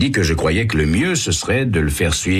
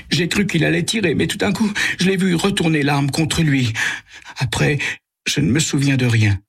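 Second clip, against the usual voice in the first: trembling; 8 semitones higher than usual. A narrator's reading. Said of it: "Il parle en tremblant".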